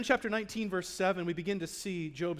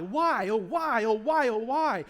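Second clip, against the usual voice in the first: slow, louder cry of "why", drawn out in despair; anguished lament; 6 dB louder, slower than usual. Acted. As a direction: let each "why" ache, slow and loud. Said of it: "he's crying out that question".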